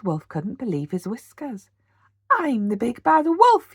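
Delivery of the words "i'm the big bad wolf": much higher, louder howl. A narrator's reading. The wolf can't believe the house stands, howling. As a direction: howl it out in outrage. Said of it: "he howled".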